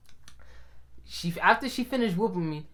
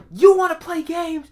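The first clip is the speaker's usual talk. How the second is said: high, raised-voice scolding list; mom yelling; 9 semitones higher, 5 dB louder than usual. Retold or imitated. Mom scolding son over grades, high yelling. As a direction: imitate a mother yelling down the hall. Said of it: "yelling down the hall".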